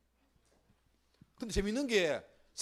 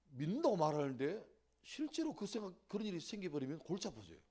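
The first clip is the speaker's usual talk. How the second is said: ordinary delivery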